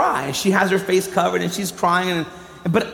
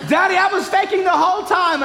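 put-on gleeful toddler voice; 11 semitones higher than usual. Little daughter tells her dad she faked crying, gleeful. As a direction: crow it like a three-year-old who fooled dad. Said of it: "she goes".

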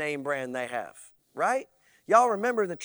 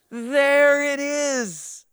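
mock Jesus approval; 7 semitones higher, 6 dB louder, much slower than usual. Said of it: "as if Jesus goes".

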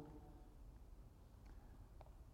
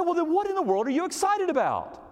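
very high, exasperated question; hammed-up incredulous retort; retold bafflement; 13 semitones higher than usual. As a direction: throw the question out high in mock disbelief.

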